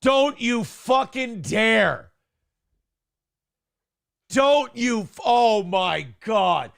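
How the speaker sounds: slow, loud outraged protest. Real, not acted. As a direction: drag out the words in outrage. Protesting a story's set-up.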